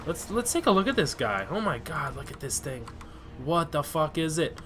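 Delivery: usual speaking voice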